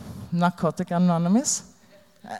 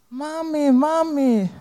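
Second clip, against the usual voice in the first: put-on higher little girl's cry; child crying mommy; 9 semitones higher than usual. Daughter calling her mother as police take her, higher child's cry.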